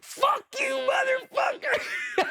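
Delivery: very high, strained mock scream; retold screaming. Leader screaming in the new guy's face, mock enraged scream. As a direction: imitate screaming in someone's face, drawn out. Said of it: "I'm, like, screaming".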